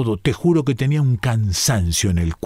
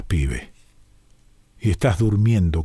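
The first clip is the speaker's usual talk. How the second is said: plain reading voice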